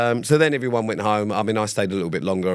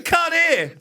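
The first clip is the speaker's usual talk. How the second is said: very high mock innocent reply; cheeky high-pitched fake deafness; playing deaf Sam. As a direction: squeak it out, pretending not to hear. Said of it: "he's going".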